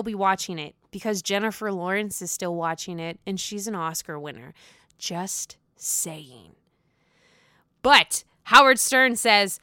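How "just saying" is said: whispered, sly little aside. Real, not acted. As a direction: whisper it like a secret jab. Sly aside after argument.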